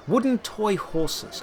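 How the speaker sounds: ordinary delivery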